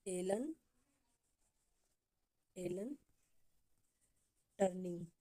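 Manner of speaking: one word at a time, long pauses; slow dictation. Shown as a demonstration. Teacher dictating a name for copybooks, word by word. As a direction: leave time to write after each word. Dictating after asking students to write.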